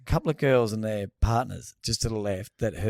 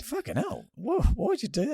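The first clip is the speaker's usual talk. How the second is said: high, incredulous retold outburst; mock shock; 13 semitones higher than usual. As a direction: squeak out disbelief.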